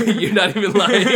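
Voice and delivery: laughing while talking. Real, not acted. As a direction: speak through laughter. Laughing at the spoiler.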